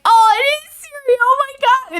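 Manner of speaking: hammed-up high-pitched amazement; excited recognition. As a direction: imitate a listener thrilled at a discovery. Imitates listener mistakenly recognising Ciri.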